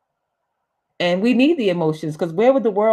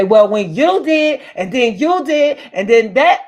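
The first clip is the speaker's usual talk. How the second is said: loud, mock-angry tit-for-tat accusation; argumentative comeback; 4 semitones higher, 9 dB louder than usual. Speaker acts out how not to answer someone upset, loud mock quarrel. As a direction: fire back accusations, much louder and higher, as if arguing.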